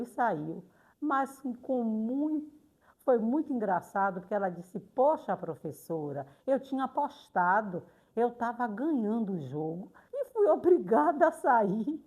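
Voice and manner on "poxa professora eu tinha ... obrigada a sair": imitated grumbling that builds to a loud protest; mock aggrieved protest, building; upset pupil. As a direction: complain to the teacher, rising to a loud final "poxa". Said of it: "ficou chateada comigo".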